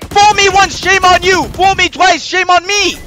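high, loud measured shouting; exasperated declaiming. Real, not acted. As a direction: proclaim each phrase loudly.

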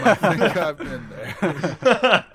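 laughing. Real, not acted. Laughing at the Adam joke.

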